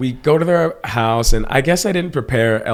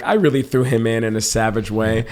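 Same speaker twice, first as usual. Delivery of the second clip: normal talking voice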